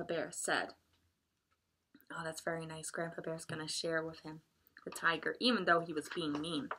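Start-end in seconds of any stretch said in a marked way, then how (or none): none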